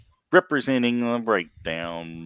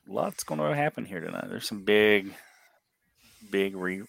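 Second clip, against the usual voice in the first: normal talking voice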